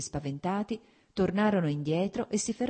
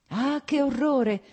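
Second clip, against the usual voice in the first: drawn-out cry of horror, stress on "che"; slow, loud "Ah, che orrore!" leaning on "che"; horrified exclamation; 9 semitones higher, 7 dB louder, slower than usual. Acted. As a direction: near tears, "che" pushed hard.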